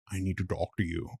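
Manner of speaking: put-on quiet, low Indian accent; doctor in an Indian accent. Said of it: "I wanna do his accent so bad".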